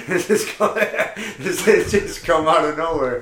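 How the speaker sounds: laughing through the words; a real laugh carries the line. Real, not acted. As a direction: breaks up while teasing. Laughing at partner's comment.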